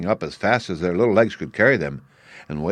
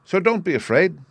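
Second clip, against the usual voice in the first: higher, reassuring Woodman voice; Tin Woodman; 10 semitones higher than usual. A narrator's reading. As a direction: gently reassure the mice, pitched up. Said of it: "said the Woodman".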